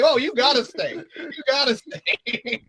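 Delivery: high, delighted urging, slowing down; gleeful villain. Retold or imitated. Robber delighted to keep the blind hostage, high gleeful urging. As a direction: savour keeping him hostage. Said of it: "He's like".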